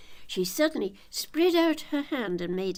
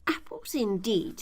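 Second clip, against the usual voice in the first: a touch of annoyed scorn, raised; angry; 6 semitones higher than usual. A narrator's reading. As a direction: sneer it back crossly. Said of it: "said the rabbit angrily".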